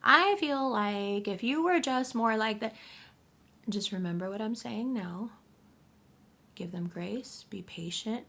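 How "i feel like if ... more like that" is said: mocking a pushy person, much higher and louder; whiny demanding voice, stretched out slowly; entitled person. To you, pushy people press demands in a whiny mocking voice. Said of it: "they're gonna be like".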